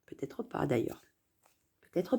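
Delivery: breathy whisper, almost to herself; whispered aside. Real, not acted. Talking to herself about enemies, whispered. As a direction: drop to a whisper and second-guess it.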